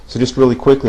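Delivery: ordinary talk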